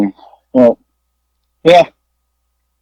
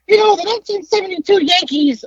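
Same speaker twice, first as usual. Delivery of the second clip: much higher squeaky mock Howard; 14 semitones higher than usual. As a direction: squeak out the ramble like the friend. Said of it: "squeaky voice".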